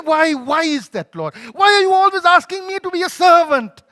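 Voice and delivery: high, loud, building protest; impassioned, self-pitying rant; indignant complaint to God. Retold or imitated. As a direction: cry out "why" as if badly wronged. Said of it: "I was sitting there saying".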